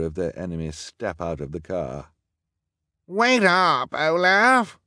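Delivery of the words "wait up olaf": high, loud drawn-out call; crying out. A narrator's reading. Henchman cries after his boss in the smoke. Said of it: "the bald man cried".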